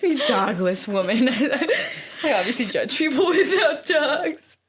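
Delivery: real laughter breaking through; laughing. Real, not acted. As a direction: giggle as you repeat the insult. Laughing at her own insult.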